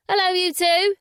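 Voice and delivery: high, loud call down the stairs; woman calling to guests from upstairs. Acted. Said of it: "she called down".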